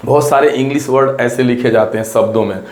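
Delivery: ordinary talk, nothing marked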